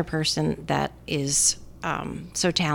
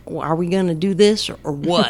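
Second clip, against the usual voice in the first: imitating George Bush; 6 dB louder than usual. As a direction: quote Bush in a lightly Texan voice. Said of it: "Sam Rockwell plays George Bush".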